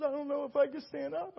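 high, soft voice of someone overwhelmed; imitated shaking. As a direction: act out her trembling weakness, high and quiet. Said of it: "She just started shaking".

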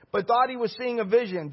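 usual speaking voice

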